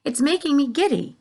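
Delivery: high, giddy spider voice; a fluttery lady spider; Miss Spider voice. A narrator's reading. Miss Spider calling down in a giddy voice.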